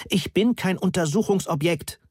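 high-pitched, understated cry of protest; mild narrated yell. A narrator's reading. Moni objecting to being studied, mildly yelled. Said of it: "schrie Moni".

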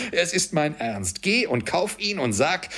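high, insistent excitement; eager assurance. A narrator's reading. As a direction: insist that you truly mean it. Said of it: "versicherte Scrooge". (aufgeregt beteuernd)

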